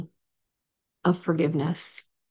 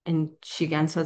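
normal talking voice

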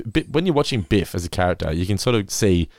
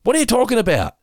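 high, exasperated mock outburst; angry retold; 14 semitones higher than usual. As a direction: imitate a customer losing it at the cashier. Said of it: "like, angry".

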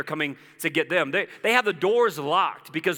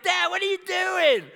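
high, drawn-out imitation of kids; mock a child's high-pitched call to Dad; 3 dB louder than usual. Kids behind dad on the stairs, playfully voiced high. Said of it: "they're gonna be like".

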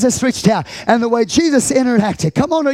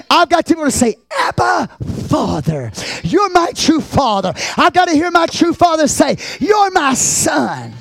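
impassioned preaching; 6 semitones higher than usual. Real, not acted. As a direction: cry it out to the congregation. Preaches at full force.

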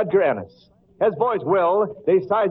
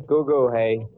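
put-on lower, gooey baby talk; 8 semitones lower, slower than usual. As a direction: coo it like a crooner infant. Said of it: "open his big blue eyes and say".

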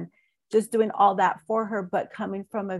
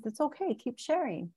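higher and softer, gently coaxing; reassuring therapist; 5 semitones higher, 5 dB quieter than usual. Retold or imitated. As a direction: soothe a tearful client, softly and high. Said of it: "She says".

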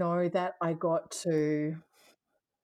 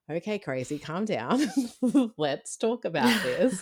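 laughing husband imitation; 5 semitones higher, 6 dB louder than usual. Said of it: "he would just be like".

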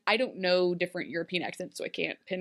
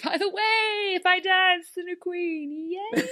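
high, hammed-up shout; mock glee, pitched way up; mock cheery announcement; 10 semitones higher, slower than usual. Mother announcing grim news on a birthday, mock cheery.

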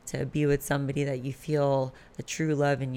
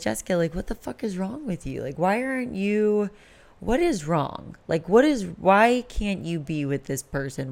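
higher, exasperated talking-to; retold self-scolding; 5 semitones higher than usual. Woman berating herself over her relationship, exasperated. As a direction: imitate giving yourself an exasperated scolding.

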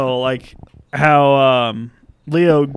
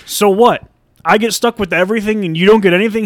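raised, louder mock outrage; indignant protest; 5 semitones higher, 4 dB louder than usual. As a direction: protest the unfairness, pitched up and loud. Acted indignant protest of accomplice.

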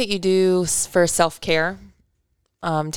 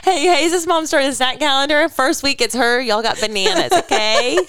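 high, laughing teasing voice; mock announcement; 7 semitones higher than usual. Host teasing guest about bringing bananas, high and laughing. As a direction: tease it like a bossy team mom. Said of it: "You bring banana".

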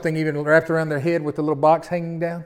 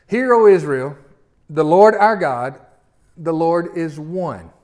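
slow, weighty reading of the verse; solemn scripture reading; 6 dB louder, slower than usual. Acted. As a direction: lay each phrase down slowly and solemnly. Reading scripture aloud slowly.

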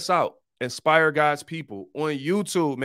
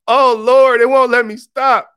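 much higher and louder, a hammed-up cry of alarm; mock panic; 10 semitones higher, 13 dB louder, slower than usual. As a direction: ham it up as if trapped on air. Mock panic, broadcast will not end.

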